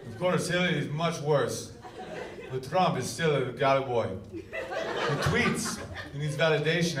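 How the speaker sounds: put-on Putin-style Russian accent.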